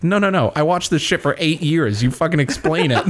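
mock-indignant demand; indignant protest. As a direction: protest like a fed-up fan. Acting out his protest as viewer.

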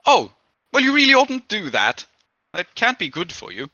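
high, gently scolding lunch lady. Acted. Lunch lady told of wrestling a demon, gently scolding. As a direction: tut at a reckless regular. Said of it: "and she says".

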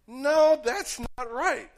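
high loud "No", then slow "that's not right"; firm refusal. Pretend. Believer rejecting the enemy's lie, loud then slow. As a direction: push the lie away with a sharp high no. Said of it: "you'll go".

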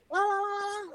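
high trilling phone ring; imitated phone ring. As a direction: trill it like a ringing telephone. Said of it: "the phone is ringing".

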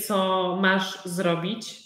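ordinary talk

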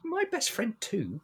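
high, slow, wounded question; hurt and quiet. Acted. Hurt reply in character.

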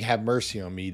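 usual speaking voice

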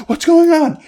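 very high-pitched mock panic question; squeaky acted confusion; imitated bewilderment. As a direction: play her flustered, voice way up. Retells her turning away, bewildered.

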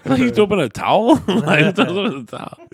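high, loud incredulity through laughter; real laughter breaking up the question; laughing disbelief. Real, not acted. Repeats incredulous question while laughing.

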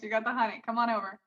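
low, soft seductive invite; sultry come-on. Acted. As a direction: lure them over with a purr.

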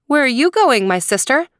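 lightly voiced girl, starting high. A narrator's reading. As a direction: call out a friendly question as a girl, lightly acted. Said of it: "The girl looked up and said".

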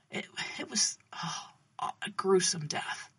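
halting, softly breathed words; hushed, hesitant and pained. Acted. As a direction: falter quietly over something dreadful. Narrator moved by the martyr's death.

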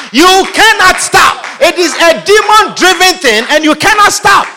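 full-force preacher cry; fiery preaching. Real, not acted. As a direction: hammer cannot stop at the congregation.

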